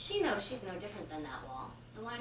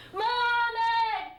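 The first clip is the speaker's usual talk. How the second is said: much higher, much louder sung-out yell; huge scream; 14 semitones higher, 7 dB louder, slower than usual. Retold or imitated. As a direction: belt it across the apartment. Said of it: "she screams out in this huge voice".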